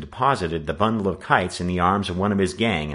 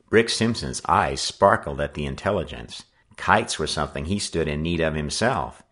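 ordinary delivery, nothing marked

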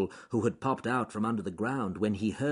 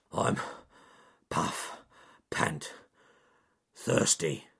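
puffing and panting bear; out of breath; 4 semitones lower, much slower than usual. A narrator's reading. Charles Growly Bear reaching the lake, panting and out of breath. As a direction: gasp it out, winded from running. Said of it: "out of breath".

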